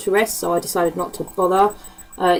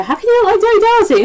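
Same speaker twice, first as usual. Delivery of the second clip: mock outrage; 12 semitones higher, 6 dB louder, faster than usual. Real, not acted. Mock outrage at Dirty Dancing haters.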